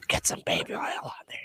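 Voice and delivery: softly whispered aside, no voice; mock whisper. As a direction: whisper the direction like a sly joke. Mock directing Virgil's photo shoot.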